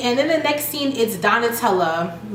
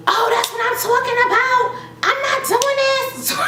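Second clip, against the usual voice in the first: mock furious wife, pitched way up; Lyrica losing it; 14 semitones higher than usual. Lyrica blowing up, acted high.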